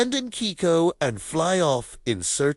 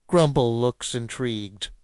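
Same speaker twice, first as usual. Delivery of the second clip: usual speaking voice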